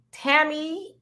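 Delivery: the name said simply as "Tammy", two syllables, stress on the first; simplified name. Real, not acted. Reading a viewer's name on the live show, says plain "Tammy". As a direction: settles on "Tammy" so as not to jack up the name. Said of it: "I'm gonna just say it like that".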